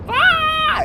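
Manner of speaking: very high, sustained "aah" scream; reenacted high-pitched shock scream; mock scream. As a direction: squeal out a playful shriek. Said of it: "And I'm like".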